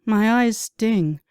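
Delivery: usual speaking voice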